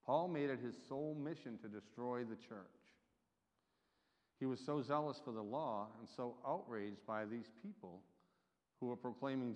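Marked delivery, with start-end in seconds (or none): none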